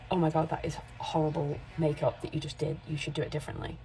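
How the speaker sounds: ordinary talk, nothing marked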